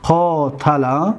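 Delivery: قاتل drawn out: qaa-ta-la; slow dictation; slow model word. Shown as a demonstration. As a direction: stretch قاتل for the class. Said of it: "قاتل را ما چیکار کردیم؟".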